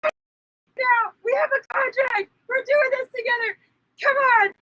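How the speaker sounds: rally her like a coach in a crisis; high pleading pep talk; urgent coaching. Retold or imitated. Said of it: "And she's going".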